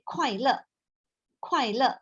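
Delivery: 快乐 with sharp falling fourth tones; 快乐 falling tone, aspirated K. Shown as a demonstration. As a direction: drop hard on both syllables of 快乐. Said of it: "fourth tone".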